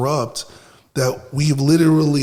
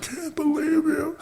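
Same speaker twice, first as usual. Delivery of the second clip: much higher, slower, softer mock sob; mock crying; 4 dB quieter, slower than usual. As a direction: whine it like an upset friend, mocking. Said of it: "called me hysterical crying".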